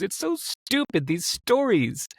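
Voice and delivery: high, drawn-out mock-tearful lament; imitated tearful exclamation. Woman lamenting a long-held mistake, imitated tearful high voice. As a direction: imitate someone crying, pitch leaping up on "stupid". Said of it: "she started crying and she goes".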